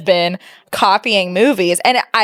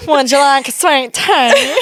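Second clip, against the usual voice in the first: slow, higher caricature of a Southern drawl; wildly overdone Southern twang, half laughing; mocking bad Southern accent; 4 semitones higher, slower than usual. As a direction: mangle the Southern accent to tease. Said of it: "You said".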